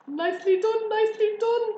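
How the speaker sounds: high, loud praise; cheering cry. A narrator's reading. Goose cheering the pig's dodge, excited cry. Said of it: "cried the goose".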